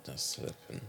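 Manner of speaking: whispered, disapproving aside. Real, not acted. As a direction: breathe it out as a verdict. Quiet reaction to cheating story.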